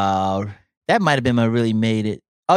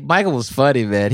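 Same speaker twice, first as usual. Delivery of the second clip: ordinary talk, nothing marked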